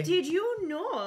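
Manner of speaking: put-on slow, high drawn-out Canadian accent; mock Canadian accent. Cohost teases a space story, slow mock Canadian accent. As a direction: stretch it out in a jokey Canadian accent. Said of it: "I don't think that's a Canadian accent".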